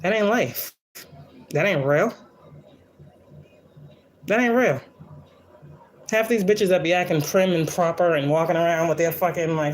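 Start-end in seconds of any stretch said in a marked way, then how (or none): 4.3-4.8 much higher, insistent retort; emphatic repeat. Real, not acted. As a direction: fling it up high, scornful. Rejecting prim expectations, repeats.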